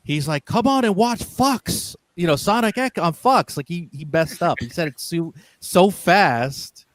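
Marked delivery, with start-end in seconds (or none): 0.4-3.6 high, upbeat promo announcer imitation; imitated TV promo. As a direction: sell the show like a voice actor's promo. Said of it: "he did promos for Fox".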